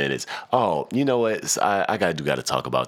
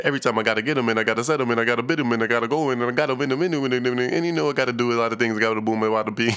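[name] imitation; 5 semitones higher, much faster than usual. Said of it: "he said".

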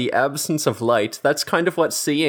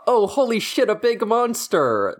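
mock surprise; 9 semitones higher than usual. Imagined sphinx riddle answer exclaimed.

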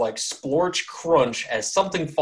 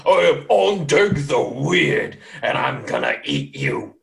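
Ondeg the Weird; 4 dB louder, slower than usual. Acted. Giant beckons out to the glowing scarecrow, booming threat. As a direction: announce yourself as a menacing giant. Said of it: "the Giant beckon out and it says".

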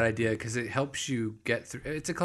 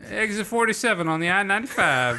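higher, louder ad read; radio ad voice; 8 semitones higher, 5 dB louder than usual. Pretend. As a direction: pitch it like a local radio ad. Radio ad bit.

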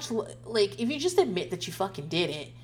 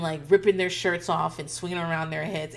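ordinary talk, nothing marked

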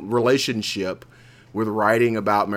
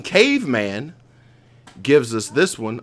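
ordinary talk, nothing marked

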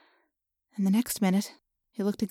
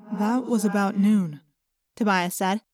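calm voice with an echo round it; Tobias's echoing voice. Acted. Tobias working out Rachel's morphing time, echoing and even.

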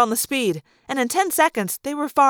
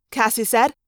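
ordinary delivery

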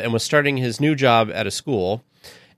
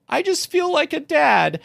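higher, slow, voice catching as if crying; teary confession; slower than usual. Retold or imitated. Retelling what he told his wife while crying, teary and slow. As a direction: relive the tears, slowly. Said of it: "and I started crying".

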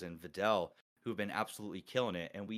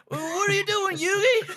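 laughing high squeaky Southern-accent imitation, much higher and louder; imitating Southern accent; 12 dB louder than usual. Host imitates his cohost's Southern skit character, laughing through a high Southern accent. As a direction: mimic the friend's Southern character voice, cracking up. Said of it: "your little Southern accent".

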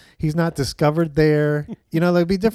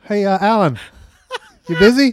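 raised, higher calling voice; 5 semitones higher, 6 dB louder than usual. Acted. Director calling Alan over on set, calling out. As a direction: call over to an actor on set, loud and high.

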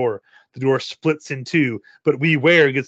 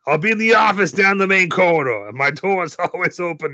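lightly voiced creepy boss; a hint of a sly manager; 5 semitones higher than usual. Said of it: "Mister Grady twice".

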